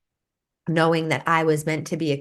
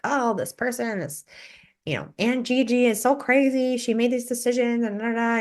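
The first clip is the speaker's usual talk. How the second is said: high, whiny imitation of a parent venting; a parent griping about a relative, mock high whine; mock complaining parent; 7 semitones higher than usual. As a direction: play the exasperated parent, pitched up. Parent complaining about Aunt Gigi.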